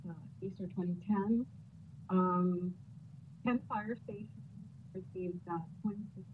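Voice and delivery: audio cutting out; bad connection. Real, not acted. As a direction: voice dropping away on a bad line. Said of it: "your audio is cutting out".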